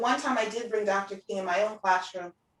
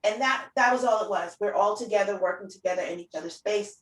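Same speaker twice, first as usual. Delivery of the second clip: ordinary talk, nothing marked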